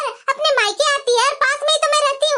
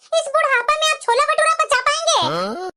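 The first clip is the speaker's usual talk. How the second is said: ordinary talk, nothing marked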